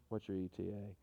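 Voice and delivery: low, soft, even radio query; steady voice. Retold or imitated. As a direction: murmur it calmly like an officer into a radio. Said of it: "he kept that steady voice".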